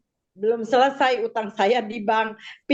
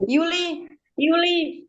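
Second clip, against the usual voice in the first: a high, drawn-out call of a name from somewhere unseen; retold voice calling out; 7 semitones higher, slower than usual. A voice calls a woman's name as she lies in surgery, high and drawn out. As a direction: call the name like a distant voice summoning her. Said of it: "ada orang panggil Yuli".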